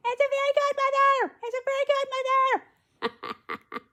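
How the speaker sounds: very high, loud squeal; excited announcement. Acted. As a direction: squeal it out in a put-on voice. Excited announcement of the fairy godmother.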